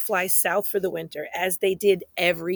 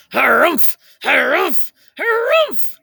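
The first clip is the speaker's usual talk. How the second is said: loud harumphs climbing higher each time; furious bird yell; 8 semitones higher, 8 dB louder, slower than usual. Acted. Bongo bird furious at the squirrels, loud rising harumphs. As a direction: huff it out like an outraged bird. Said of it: "yelled a bongo bird".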